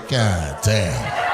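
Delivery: low, drawn-out god damn; a friend reacting to her, lightly voiced; mock friend's god damn. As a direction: imitate the impressed friend. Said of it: "Her friend said".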